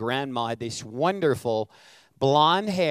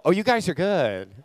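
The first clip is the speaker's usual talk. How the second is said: normal talking voice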